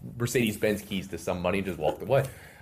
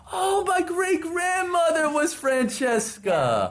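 very high, slow gushing exclaim; mock an older admirer; gushing stranger; much slower than usual. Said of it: "someone's like".

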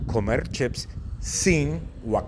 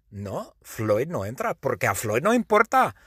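ordinary delivery

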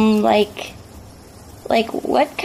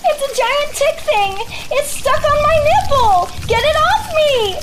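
panicked; 9 semitones higher than usual. Acted. Tick stuck on her.

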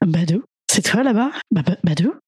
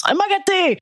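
uncle calling his wife; 7 semitones higher than usual. Retold or imitated. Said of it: "il appelait sa, son ex-femme comme ça".

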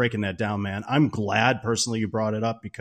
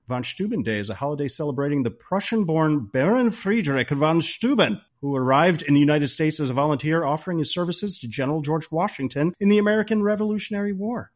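raised, declaimed lecture voice; mock history lesson; 5 semitones higher than usual. Guest giving co-hosts a history lesson, grandly declaimed. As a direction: grandly recite the facts, names rolled out. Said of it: "give you a little history lesson".

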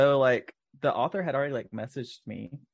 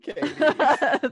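high mock-grateful name call; teasing sing-song; 5 dB louder than usual. Real, not acted.

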